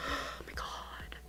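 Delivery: a hushed, starry-eyed oh my God; whispered to herself; awed whisper. Real, not acted. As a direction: breathe it out in a whisper. Gushing about childhood toy.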